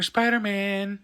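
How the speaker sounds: high, drawn-out name; villain's realisation. Retold or imitated. Villain working out the secret identity, high and drawn-out. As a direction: stretch the name as it dawns. Goblin realising who Peter is.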